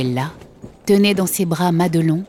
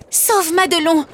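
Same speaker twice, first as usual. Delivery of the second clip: put-on higher urgent child's cry; girl crying out; 12 semitones higher than usual. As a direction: throw the plea after the puppet. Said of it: "lui cria-t-elle".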